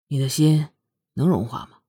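low, wavering resolve let through; softened tone. A narrator's reading. Prince asks her after his heart wavers, tone loosening. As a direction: ease off and ask low. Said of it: "听出凌风口气的松动".

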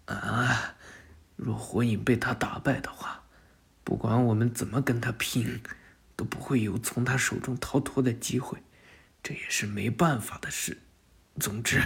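put-on wounded boy whispering his reasons, fading; weak whisper. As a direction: whisper through the pain. Wounded Kawaki speaks weakly.

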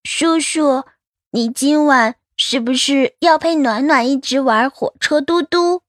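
put-on high, childlike question; wheedling little child. Child asking doctor to play trains tonight, high and wheedling. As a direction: tug at uncle like a small child. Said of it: "暖暖蹭过来拽着夏医生的袖子".

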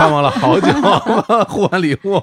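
high laughing talk. Real, not acted. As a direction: laugh through the words. Laughing over gift exchange.

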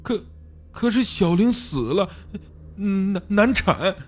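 high, stammering wail; bitter crying. A narrator's reading. Xiao Li reveals his wife died in childbirth, sobbing. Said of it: "小李又放声痛哭".

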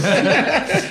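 short high burst of laughter; real amused laugh. Real, not acted. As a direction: laugh out at the absurdity. Laughing at fans dressing up.

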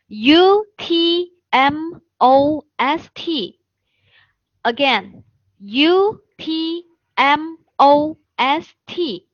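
slow letter-by-letter spelling. Shown as a demonstration. Teacher shows students how the word is spelled, spelling out. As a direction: spell it out slowly, each letter set apart. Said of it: "这个单词怎么拼写的".

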